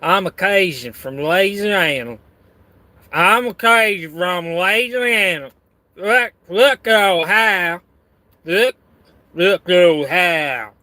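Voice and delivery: exaggerated, drawn-out Cajun accent; hammed-up Cajun impersonation. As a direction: put on a thick Cajun accent. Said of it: "That's my Cajun accent".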